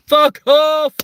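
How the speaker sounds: high, full-force shriek of shock; startled yell. Retold or imitated. Beachcomber recoiling from someone's collarbone, startled yell. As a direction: scream it out in alarm.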